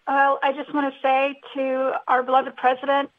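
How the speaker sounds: ordinary talk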